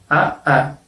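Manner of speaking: "A, a" as two distinct vowels with a gap; separate vowels. Shown as a demonstration. Teacher showing the letter A twice, said separately. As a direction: say "A" twice, each on its own. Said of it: "como si estuviesen escritas separadamente".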